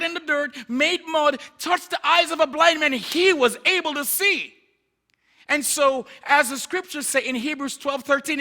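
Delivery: loud, high, driving sermon delivery; preaching at full force. Acted. Preacher telling church Jesus can still heal, impassioned proclamation. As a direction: build to a ringing, emphatic proclamation.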